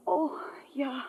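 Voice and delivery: tearful. Acted. Marta upset after phone call.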